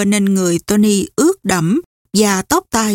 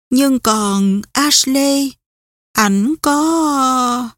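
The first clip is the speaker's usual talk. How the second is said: higher, slow and hesitant, left unfinished; faltering question; 4 semitones higher, much slower than usual. A narrator's reading. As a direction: draw out an anxious question and let it hang. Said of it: "Nàng ấp úng". (ngập ngừng, bỏ lửng)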